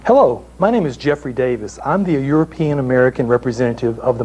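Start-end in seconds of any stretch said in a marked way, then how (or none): none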